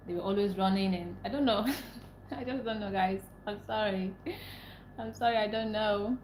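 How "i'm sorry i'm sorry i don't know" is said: normal talking voice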